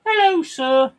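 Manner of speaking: put-on high, loud piping kid voice; squeaky imitation of a polite child; kids' voice. Kids greeting a bearded stranger, high piping voice. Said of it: "and my kids go".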